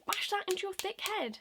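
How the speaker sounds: high-pitched indignant telling-off; exasperated scolding. Real, not acted. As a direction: drive it home, fed up. Drunk rant at excuse-makers.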